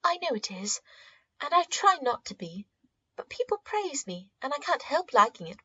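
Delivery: confessing niece, half ashamed; lightly voiced girl, a touch defensive; young girl Rose. A narrator's reading.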